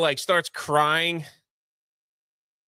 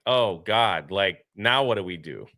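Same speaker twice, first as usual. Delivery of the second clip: lower, deflated oh God; mock despair; 8 semitones lower than usual. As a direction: sound helpless and stuck. Characters stuck and helpless.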